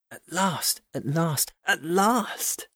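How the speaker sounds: high, wondering exultation; rapt elation. A narrator's reading. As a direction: savour each repeat, the last one highest. Narrator's long-held wish comes true.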